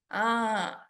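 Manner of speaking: ordinary talk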